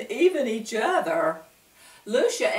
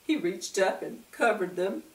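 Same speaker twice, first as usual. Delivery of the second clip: ordinary delivery, nothing marked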